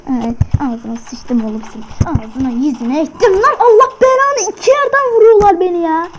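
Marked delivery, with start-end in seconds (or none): 3.0-6.1 higher, louder outraged yell; angry yelling. Real, not acted. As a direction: rage at being shot. Shot from two sides.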